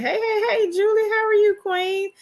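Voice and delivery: very high, happy hello; high-pitched, gushing welcome; excited greeting. Real, not acted. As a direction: greet a dear friend in a high, delighted voice. Greeting a viewer who sent love.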